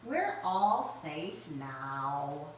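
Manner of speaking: slow, low soothing; comforting. Acted. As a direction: soothe him gently. Owl comforts.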